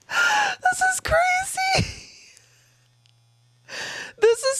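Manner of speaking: high, shrieking surprise; real startled disbelief, rising into a wail; real shocked squeals. Real, not acted. Toy suddenly sucks her finger.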